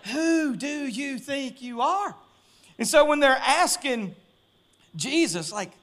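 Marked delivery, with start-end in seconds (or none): 0.1-2.1 slow, softer, raised-pitch mock challenge; drawn-out challenge. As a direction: stretch every word out, pitched up. Drawn-out mimicked challenge, catchphrase repeated.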